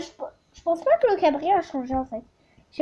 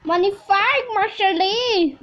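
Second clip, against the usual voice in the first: louder, slow, laughing tenderness; fond praise; 5 semitones higher, 3 dB louder, slower than usual. Real, not acted. Girl praises her horse fondly, with a laugh. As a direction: coo over the horse.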